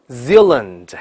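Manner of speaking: Zealand as ZEAL-lund, dark L then clear L; drawn-out Zealand, L held; American double L. Shown as a demonstration. Teacher asks how 新西兰 is read, double L. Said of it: "发两个Zeal".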